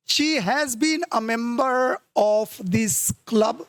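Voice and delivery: slow, deliberate classroom reading; slow dictation read. Shown as a demonstration. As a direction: read the line out very slowly. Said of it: "reads the sentence slowly aloud".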